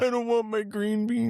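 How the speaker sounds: put-on very high whiny child voice; little kid voice. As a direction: whine like a fussy toddler. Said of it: "sound just like a little kid".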